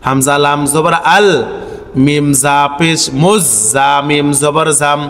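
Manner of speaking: slow, measured spelling chant; correct pronunciation drill. Shown as a demonstration. Teacher shows learners the correct reading, chanted evenly. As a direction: recite syllable by syllable, holding long sounds.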